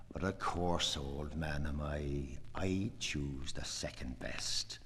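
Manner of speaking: hushed whispered confession; whispering. Acted. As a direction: breathe it in a whisper.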